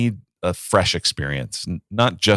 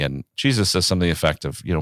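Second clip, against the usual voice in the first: usual speaking voice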